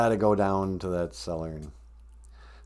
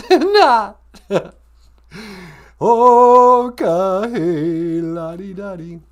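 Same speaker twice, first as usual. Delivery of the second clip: high, loud, drawn-out teasing; high-voiced sidekick, dismissive; 9 semitones higher, 11 dB louder, much slower than usual. Sidekick brushing off partner's haunting threat, high mock singsong.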